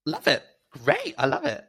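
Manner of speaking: very high, gushing praise; gushing delight. Retold or imitated. Drag queen gushing over the kids' news, very high and delighted. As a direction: squeal out the congratulations. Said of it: "So I said".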